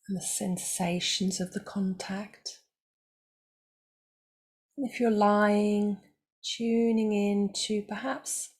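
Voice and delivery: calm, unhurried voice with long pauses; guided meditation. Acted. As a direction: guide them gently into stillness, leaving space between phrases.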